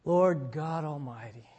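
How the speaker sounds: soft, low, unhurried prayer voice; hushed heartfelt pastoral prayer; closing prayer. Real, not acted. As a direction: pray quietly over the congregation.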